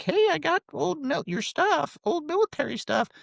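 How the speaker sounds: very high-pitched mock mom voice; imitated mom. Mom to son in parents' basement, imitated mom voice. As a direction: call it out in a high caricature of mom. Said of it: "My mom's like".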